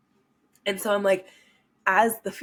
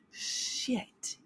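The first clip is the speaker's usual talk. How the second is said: whispered curse; 6 semitones higher, 10 dB quieter than usual. Retold or imitated. Self-reflecting, inner exclamation.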